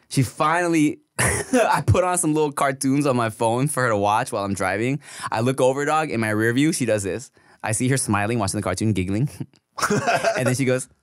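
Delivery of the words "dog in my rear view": ordinary delivery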